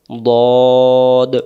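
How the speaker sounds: "দদ" with one long rounded o, no wa glide; thick, drawn-out "দদ"; heavy DAD. Shown as a demonstration. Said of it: "তাহলে এটাকে আমরা কি বলবো".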